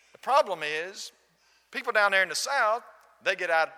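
high and loud, laying out his objection; loud retold complaint. Gentleman criticizing Southern churchgoers to the visitor, loud retold complaint. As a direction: imitate an emphatic grievance.

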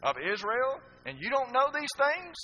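high, loud incredulous rebuke; Jesus chiding a teacher of Israel; Jesus rebuking Nicodemus. Acted. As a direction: scold the learned teacher, rising. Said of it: "Jesus said that to Nicodemus".

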